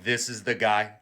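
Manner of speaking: low, flat deadpan; dry low disdain; unimpressed Pope. Retold or imitated. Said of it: "The Pope's like".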